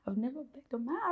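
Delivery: high-pitched disbelief at herself; retold embarrassment. Telling her friend of begging her husband, lightly mortified. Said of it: "I felt so embarrassed".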